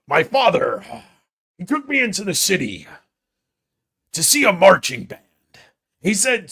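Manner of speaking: loud, theatrical mock recitation; high, declaimed phrases with dramatic pauses; Chris. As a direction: belt it out like an overblown stage monologue. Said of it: "then sometimes he says".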